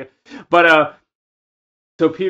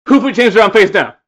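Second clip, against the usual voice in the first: high, loud outraged yell; loud drunk yell; 6 semitones higher, 9 dB louder than usual. Retold or imitated. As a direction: roar the question. Repeated rant, louder.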